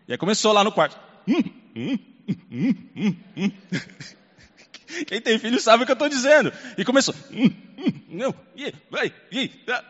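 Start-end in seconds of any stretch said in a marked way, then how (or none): 1.3-3.5 hummed whimpers; light mock whimpering child; imitated child's whimpering. 7.3-9.8 whimpered protests; light mock child's whining; imitated child's whining.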